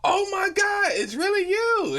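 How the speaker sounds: high crying scream of disbelief. Retold or imitated. Fan on FaceTime screaming at meeting Happy Ice. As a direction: shriek it like a starstruck fan. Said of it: "the girl starts screaming like".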